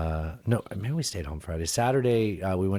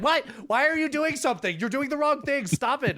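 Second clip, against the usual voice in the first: high, incredulous telling-off; mock scolding, pitched way up; exasperated. [name] catching mom binning the leftovers, exasperated and high. Exasperated reaction to mom's meddling.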